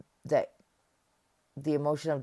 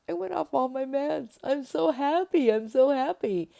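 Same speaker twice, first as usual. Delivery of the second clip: giddy mock joy; 10 semitones higher, 7 dB louder than usual. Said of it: "I'm like".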